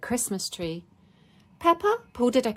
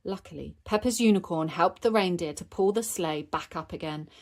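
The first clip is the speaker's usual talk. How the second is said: ordinary delivery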